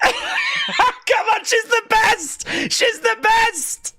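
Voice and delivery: laughing, shouting with joy; excited cheer. Real, not acted. Fan cheering [name] in the ring, excited high shouting. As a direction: cheer your idol on.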